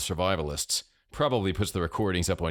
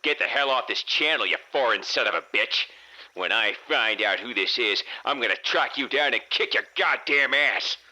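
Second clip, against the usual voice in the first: put-on outraged, higher-pitched old man's yell; old man shouting; 6 semitones higher, 3 dB louder than usual. A narrator's reading. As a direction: holler threats like a furious nasal old crank. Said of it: "and then the old man shouted".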